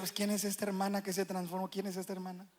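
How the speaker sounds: hushed, quick mock aside; puzzled spouse's aside. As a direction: imitate a baffled spouse under the breath. (imitando en voz baja a un cónyuge extrañado)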